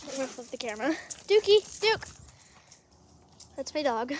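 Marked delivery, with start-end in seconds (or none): 1.3-4.2 calling out to the dog; roepen naar de hond. Real, not acted.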